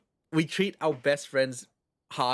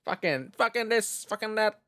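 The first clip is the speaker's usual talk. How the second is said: high-pitched string of swearing, hammed up; playful impression of Australians swearing at each other; mock Aussie swearing; 6 semitones higher than usual. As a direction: rattle off the curses in a raised mock voice. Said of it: "sounds really, intimidating".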